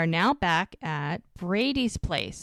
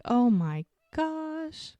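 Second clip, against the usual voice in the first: drawn-out, high, gushing oh my gosh; imitating a swooning friend; 10 semitones higher, much slower than usual. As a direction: stretch it out like you're swooning. Retold gushing reaction.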